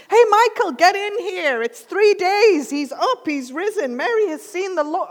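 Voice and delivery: high, urgent calling out; acted breathless excitement; excited news-bearer. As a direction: call a friend over with astonishing news, pitched high. Acting out the disciples' chaos.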